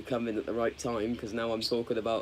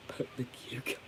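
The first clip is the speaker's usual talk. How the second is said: whispered; 11 dB quieter than usual. Real, not acted. Reads a crude comment aloud.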